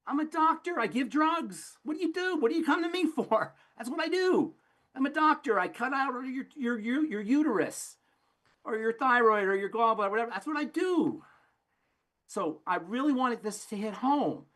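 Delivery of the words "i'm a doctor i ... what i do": much higher, mock-smug doctor voice; a doctor shrugging off the blame, mocked. Mock doctor's self-justifying speech.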